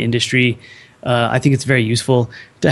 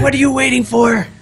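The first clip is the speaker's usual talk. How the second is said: high shouted challenge; pushing the audience to start; hype shout; 11 semitones higher than usual. Real, not acted. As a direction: yell it like a hype man. Panel cheering each other on.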